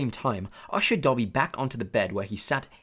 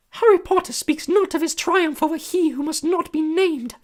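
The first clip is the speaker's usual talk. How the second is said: high, loud squeaky elf voice; a small eager servant creature, awed; squeaky house elf; 8 dB louder than usual. A narrator's reading. House elf greeting Harry, high squeaky and awed. Dobby voice.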